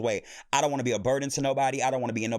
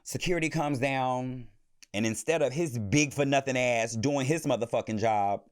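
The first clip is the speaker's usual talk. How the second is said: normal talking voice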